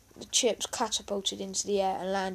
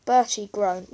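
plain reading voice

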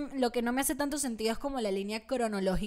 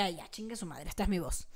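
resigned own voice; 6 semitones lower, 9 dB quieter than usual. Retold or imitated. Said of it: "trataba de que mi voz fuese como más gruesa".

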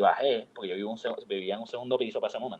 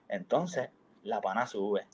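usual speaking voice

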